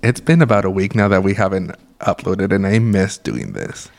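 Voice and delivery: usual speaking voice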